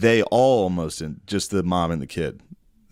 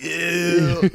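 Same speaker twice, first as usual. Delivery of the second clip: mock ew; 8 semitones higher than usual. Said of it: "you're like".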